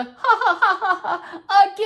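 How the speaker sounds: high, loud cackling laugh; viral TikTok laugh. Retold or imitated. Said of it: "that viral TikTok sound that's going around".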